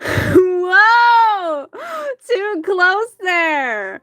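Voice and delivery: high, drawn-out, loud tipsy warning; hammed-up tipsy alarm; drunken protest. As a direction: whoop it, then stretch the words playfully. Drunk character protests being grabbed.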